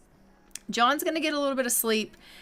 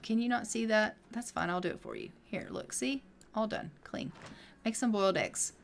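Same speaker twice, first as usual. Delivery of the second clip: mildly voiced daughter, softer, trailing off; quiet daughter voice; 8 dB quieter than usual. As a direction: hand it over with a soft, muttered sulk. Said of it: "She's like".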